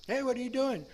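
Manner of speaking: put-on chirpy hello to an old buddy; breezy phone greeting. Cheerfully calling up a friend.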